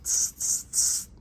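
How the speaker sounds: loud repeated tss noises; sharp hissed monkey chatter. Shown as a demonstration. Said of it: "That must be in the language of the author, what monkeys say".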